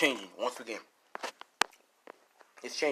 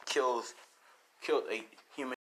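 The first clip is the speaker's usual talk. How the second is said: normal talking voice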